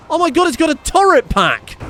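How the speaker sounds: very high, loud outcry; alarmed shout. Real, not acted. Player discovering the enemy's turret, alarmed. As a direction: shriek it in disbelief.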